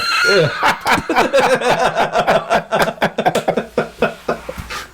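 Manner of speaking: long, high peals of laughter; big laugh. Real, not acted. Cracking up at the Braille joke, real laughter. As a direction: crack up at the joke, trailing away.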